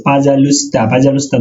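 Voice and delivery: pozhaluysta in Russian; Russian word. Shown as a demonstration. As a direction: say পাজালুস্তা as Russian pa-zha-lu-sta. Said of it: "দয়া করা". (রুশ উচ্চারণে পাজালুস্তা)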